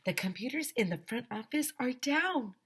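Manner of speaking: high-pitched mock shout. A narrator's reading. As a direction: call out the bad news, pitch climbing high. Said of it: "shouted Missus Patty".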